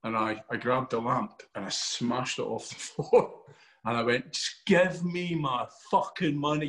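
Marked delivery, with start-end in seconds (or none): 4.3-6.7 slow, higher-pitched retold demand; angry demand. Actor in character demands money from the panel, acted angry demand. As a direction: draw out a mock-furious demand.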